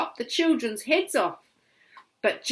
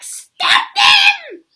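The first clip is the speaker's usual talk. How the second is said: extremely high, loud witch shriek; witch scream; 16 dB louder than usual. Acted. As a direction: screech it in fury. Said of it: "screamed the witch".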